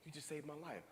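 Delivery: lightly voiced tearful girl, barely there; hushed tearful girl. Crying girl thanking speaker for saving her life: soft hushed voice. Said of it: "She's like".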